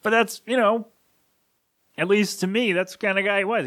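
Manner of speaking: fighting back tears mid-sentence; voice breaking. Real, not acted. Remembering his late uncle on the show, voice cracking.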